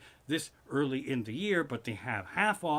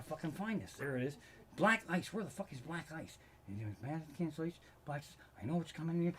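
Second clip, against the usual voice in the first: much softer searching mutter; muttering to himself; 9 dB quieter, faster than usual. Real, not acted. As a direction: mumble while digging through papers. Muttering while searching his notes.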